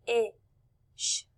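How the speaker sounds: "Fish" split into f, short i, sh with pauses; sounded out. Shown as a demonstration. Teacher blending with the class, sounded out. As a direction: say "Fish" sound by sound, slowly separated. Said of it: "let's blend it together".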